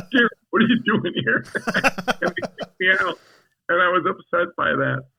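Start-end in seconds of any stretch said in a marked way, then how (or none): 0.1-1.5 high, loud retold challenge; acted-out indignant surprise, pitched up; mock incredulous challenge. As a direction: imitate an astonished security guard. Security catching him sneaking in.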